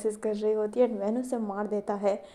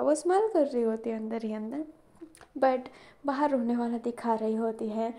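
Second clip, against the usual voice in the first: ordinary delivery, nothing marked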